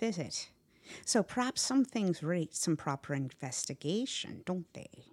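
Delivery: put-on kindly, folksy old servant; wise old Catherine. Old Catherine advising frightened Miss Muffet, kindly and knowing. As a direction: reassure the child in homely dialect.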